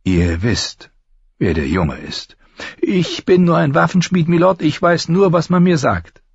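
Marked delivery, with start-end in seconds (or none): 2.8-6.2 very high, protesting craftsman voice; high-voiced smith dodging the question; master armourer, evasive. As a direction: plead ignorance to the lord, voice pitched high.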